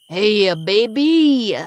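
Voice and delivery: slow, drawn-out, loud leering call; sleazy catcall. Acted. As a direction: stretch it out like a sleazy alley catcaller. Leering man in alley calls out.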